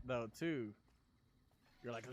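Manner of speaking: ordinary talk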